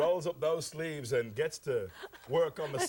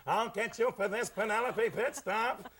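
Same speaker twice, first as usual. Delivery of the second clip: higher cartoon villain threat; cartoon cop villain; 6 semitones higher than usual. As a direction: snarl it like a cartoon baddie. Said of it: "He puts his head out the window and says".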